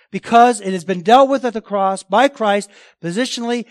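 preaching loud. Real, not acted. Preacher building to emphasis.

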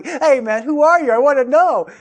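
loud, high, over-keen asking; hammed-up casual eagerness to know; friendly eager curiosity. Shown as a demonstration. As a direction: ask like an excited, curious guy. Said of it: "It's not like they're coming".

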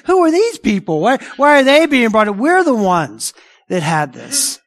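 high, incredulous, affronted voice, slowing at the end; indignant retort. Retold or imitated. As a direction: play the offended insider.

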